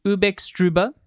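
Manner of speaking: ubextruba with ub before each vowel; Ubby Dubby speak. Shown as a demonstration. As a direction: say ubextruba as ub-ex-trub-a. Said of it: "Extra would be".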